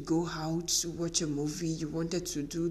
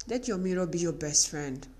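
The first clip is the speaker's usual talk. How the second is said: ordinary talk, nothing marked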